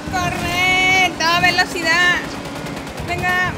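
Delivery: shouting urgently to flee; being chased. Real, not acted. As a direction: yell at them to floor it. Fleeing, shouting to drive.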